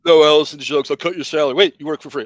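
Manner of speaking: loud, bossy put-on voice of a boss; mock boss voice. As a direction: bark it like a stingy boss.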